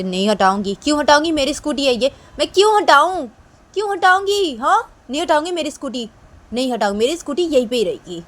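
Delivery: loud, high, defiant refusal; defiant shouting. Acted. New girl refuses to move her scooter, high defiant shouting. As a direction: shout back that you won't move it. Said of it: "वो लड़की बोलती".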